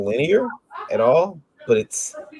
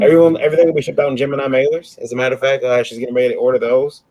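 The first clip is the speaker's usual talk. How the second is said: usual speaking voice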